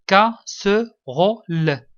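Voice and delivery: loud, slow, split syllables. Shown as a demonstration. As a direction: sound out each syllable firmly, pausing between. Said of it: "la syllabe qui contient le son S". (مقطعًا مقطعًا بصوت عالٍ)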